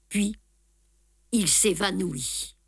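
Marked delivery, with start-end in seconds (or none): none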